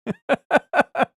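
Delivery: high, loud burst of laughter; real laugh. Real, not acted. Streamer laughing at his accent turning French, high amused laugh. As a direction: crack up at your own attempt.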